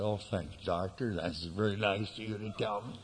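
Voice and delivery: the quiet polite answer he didn't give; meek quiet thanks. Pretend. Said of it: "Instead of that".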